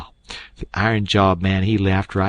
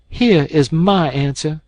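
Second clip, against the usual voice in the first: higher, firm declaration; proud defiant girl; 7 semitones higher, 5 dB louder than usual. A narrator's reading. Mary Jane answering the doctor, defiant. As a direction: stand tall and answer. Straightened herself up.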